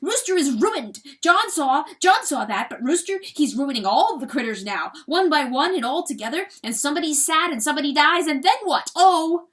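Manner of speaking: high, loud weasel rant; worked-up, quick and loud; agitated crying out. A narrator's reading. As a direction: cry out in a rising tirade. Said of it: "John Wesley suddenly cried".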